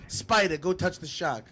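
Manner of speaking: high, loud mocking tough-guy taunt; Joe Pesci impression. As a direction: needle him like a menacing gangster. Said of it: "Pesci would've came out of me".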